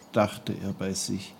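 ordinary delivery

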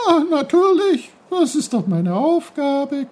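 very high, loud, gleeful brook voice; happy gurgle. Acted. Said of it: "gluckste der Bach glücklich".

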